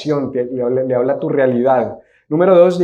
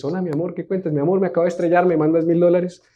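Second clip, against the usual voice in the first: higher, soft put-on loved one's voice; Gabriela; 4 semitones higher, 4 dB quieter, faster than usual. AI scammer begs wife's partner for money, imitated voice. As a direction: imitate a sweetheart on the phone, pitched up. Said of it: "pero con voz de Gabriela".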